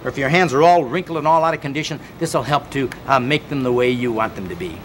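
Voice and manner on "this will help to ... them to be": ordinary talk, nothing marked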